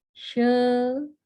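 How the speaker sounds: slow, high, held-out "Shelomo"; "She-lo-mo", slowly, with no h at the end; model reading. Shown as a demonstration. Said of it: "maka bacaan ini adalah".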